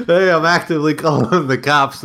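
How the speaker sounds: high, laughing imitation; laughing mock quote. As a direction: imitate him through laughter. Mimicking neighbor, laughing.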